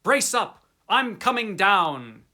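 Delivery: loud, determined shout down the line; shouted resolve. Acted. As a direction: call down to him, resolute. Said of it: "I formed and shouted a resolution".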